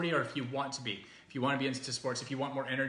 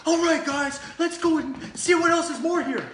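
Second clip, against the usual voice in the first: much higher, louder hyped-up hype-man voice; Billy Banks impression; 9 dB louder than usual. Vlogger playing her hype-man bit, shouting high and loud. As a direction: pump up the crowd like a fitness hype man. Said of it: "just gonna play my Billy Banks thing".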